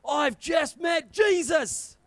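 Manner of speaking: high, loud excited announcement; excited shout. Retold or imitated. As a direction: burst in with breathless news. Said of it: "I charged inside and said".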